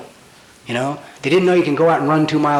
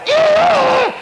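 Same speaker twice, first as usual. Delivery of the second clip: a sudden high, louder squeal of ew; high disgusted ew; 4 dB louder than usual. Retold or imitated. Answering the therapist's how are you with a squealed ew. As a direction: squeal ew like it all spilled out.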